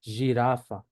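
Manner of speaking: jirafa as "zhi-RA-fa"; Portuguese pronunciation demo. Shown as a demonstration. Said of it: "Pero aquí ya sería".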